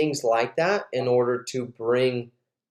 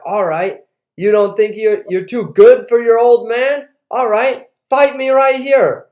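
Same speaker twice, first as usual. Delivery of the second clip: loud, high, belligerent dare; retold challenge, building in pitch and volume; drunk dad's challenge; 9 semitones higher, 9 dB louder than usual. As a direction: act out a father picking a fight. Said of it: "and he's all drunk".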